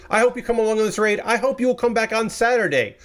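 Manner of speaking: ordinary talk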